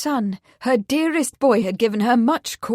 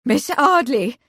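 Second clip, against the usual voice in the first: higher, louder cry; mother crying out in dismay; cried out; 4 semitones higher, 5 dB louder than usual. Acted. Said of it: "Lady Marsden cried".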